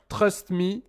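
normal talking voice

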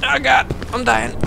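a touch of real panic as the character falls; panicked. Real, not acted. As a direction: cry out as you fall. Character falling in game.

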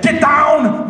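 high, hammed-up yelled warning; accented mock movie shout; action-hero shout. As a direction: yell it like an action star. Action hero shouts in parody trailer.

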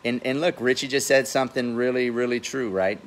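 ordinary talk, nothing marked

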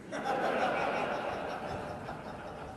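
long breathy burst of laughter; real laughter. Real, not acted. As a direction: laugh at the memory. Laughing at the joke.

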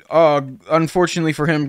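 ordinary delivery

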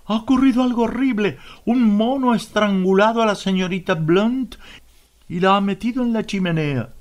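high, fast, panicked report; horrified. A narrator's reading. Miss Pope runs in pale.

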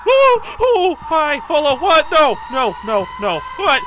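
loud, high panicked yelps; startled shouting, easing as it goes; freaking out. Real, not acted. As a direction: blurt it out in a real panic. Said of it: "I'm freaking out".

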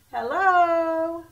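high, loud call out the window. Acted. Hen calls out the window for help, high and loud. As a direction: shout hello across the yard. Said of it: "she said".

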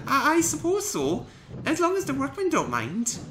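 high-voiced engine, halting and unsure; a shy engine agreeing reluctantly; young hesitant engine. A narrator's reading. Peter Sam answering the eager young engine, hesitant.

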